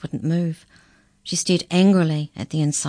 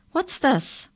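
high-pitched, demanding question; 10 semitones higher than usual. A narrator's reading. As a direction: demand to know what it is. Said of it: "he demanded".

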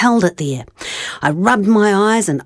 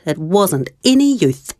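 ordinary delivery

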